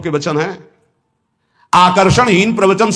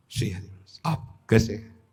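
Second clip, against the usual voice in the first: soft, lower, near-whispered greeting; a softer, lower demo of loving speech; sweet soft greeting; 6 semitones lower, 11 dB quieter than usual. Shown as a demonstration. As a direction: greet someone as gently and sweetly as you can. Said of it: "बहुत प्रिय वचन".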